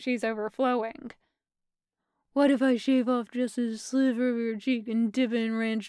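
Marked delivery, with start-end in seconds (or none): 2.3-5.9 lightly voiced doting weirdness; Stevie's weird baby talk. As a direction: relish it, half cooing, half deadpan.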